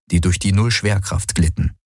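ordinary delivery, nothing marked

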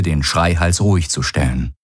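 ordinary delivery, nothing marked

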